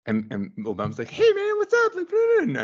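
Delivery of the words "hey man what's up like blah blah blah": very high, loud silly voice; Obama. Acted. Guest joking about Obama visiting Mandela, high silly voice. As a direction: squeal it out in a comic falsetto. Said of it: "we need to work on your voice".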